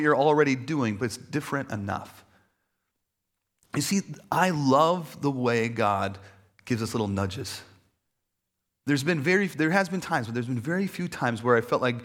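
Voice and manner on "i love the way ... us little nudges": ordinary talk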